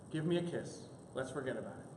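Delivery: low, soft, gentle; tender reconciliation. Acted. Wife asking husband for a kiss, softly tender. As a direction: hush it into a forgiving invitation.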